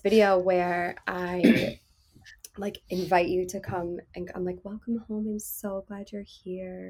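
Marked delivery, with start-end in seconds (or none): 4.6-6.9 soft, slow, intimate hush; soothing voice. Shown as a demonstration. Demoing her YouTube video to cohosts, soft soothing voice. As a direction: lull the viewer like a lover welcoming them home. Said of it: "it's a soothing voice video".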